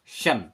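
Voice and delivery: शन with श as in शेर; correct shun. Shown as a demonstration. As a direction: close firmly on शन. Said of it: "शेर वाला".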